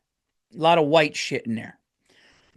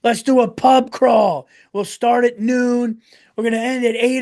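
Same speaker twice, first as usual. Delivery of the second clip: rowdy partygoers; 9 semitones higher, 5 dB louder than usual. Retold or imitated. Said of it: "But these people, like, you know".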